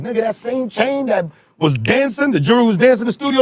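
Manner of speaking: high, mocking clown voice; hyped mockery of the fake chain; teasing high voice.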